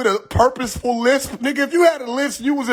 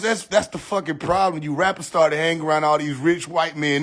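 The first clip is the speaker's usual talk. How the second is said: ordinary talk, nothing marked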